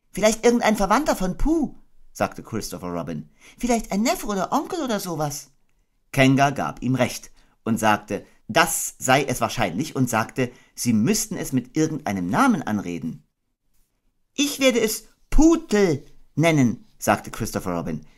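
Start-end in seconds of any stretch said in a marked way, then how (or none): 0.1-1.7 put-on high boy voice; a thoughtful boy; Christopher Robin voice. Christopher Robin guessing who Piglet is, musing. 3.6-5.5 put-on high boy voice; a thoughtful boy; Christopher Robin voice. Christopher Robin guessing who Piglet is, musing. 14.4-16.8 put-on high boy voice; a thoughtful boy; Christopher Robin voice. Christopher Robin guessing who Piglet is, musing.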